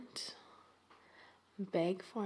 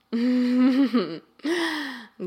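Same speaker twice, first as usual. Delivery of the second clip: loud pleased chuckle; satisfied laugh; 3 semitones higher, 11 dB louder than usual. Acted. Closing laugh.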